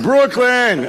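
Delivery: high, loud cheer of Brooklyn!; mock crowd cheer. Rabbi imitating the old crowd's cheer, loud and high. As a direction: call out Brooklyn! like an excited crowd. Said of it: "it was like".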